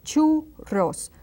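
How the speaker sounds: churros high, with a trilled RR; rolled double R. Shown as a demonstration. Teacher modelling churros with ch and rolled RR. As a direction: roll the RR hard in churros. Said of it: "two double R's there".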